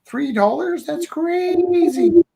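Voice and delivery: high, loud mock disbelief, last word drawn out; incredulous bidder. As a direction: exclaim like a stunned onlooker, stretching the ending. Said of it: "where people go".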